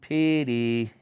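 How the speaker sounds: high sing-song name call. Real, not acted. Parent calling little Petey, high sing-song call.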